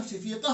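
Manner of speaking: शफीका with round ta read as h; शफीका as said at a stop; pausal h ending. Shown as a demonstration. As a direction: drop the -tun, end शफीका on -ah. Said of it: "ये रुक जाते हैं".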